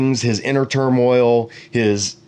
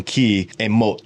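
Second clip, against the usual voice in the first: ordinary talk, nothing marked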